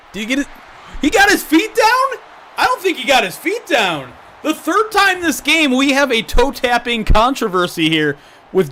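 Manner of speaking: high, loud sportscaster call; excited play-by-play. Acted. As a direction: call the replay like live TV. Live play-by-play of the catch.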